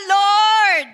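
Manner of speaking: loud, high desperate cry for help; Peter's shout. Acted. Preacher voicing sinking Peter shouting to the Lord. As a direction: yell it out like a sinking man. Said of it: "sumigaw siya".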